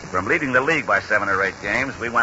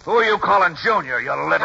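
indignant; 6 semitones higher than usual. Acted. Trainer insulted by small stranger.

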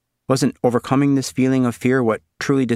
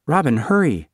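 put-on girl's urgent plea; 6 semitones higher than usual. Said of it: "Suzanne said".

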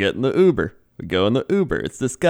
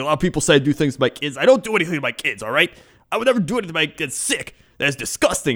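fast, higher, insistent mock defensiveness; defensive rant; 6 semitones higher, faster than usual. Uber driver to passengers, fast defensive protest. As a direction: rattle it off, hurt and defensive. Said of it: "he got very defensive very quickly".

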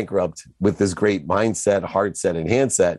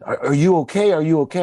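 high, alarmed imitation of a worried mother; mock alarm, pitched well up; retold terrified mom; 10 semitones higher, faster than usual. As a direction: fire off worried questions as a frightened mom. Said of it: "my mom gets terrified".

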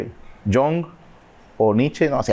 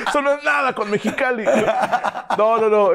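higher, said through laughter; laughing; 10 semitones higher than usual. Real, not acted. Comparing the heat to Mexicali, still laughing. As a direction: keep laughing as you talk.

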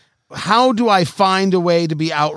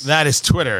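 ordinary talk, nothing marked

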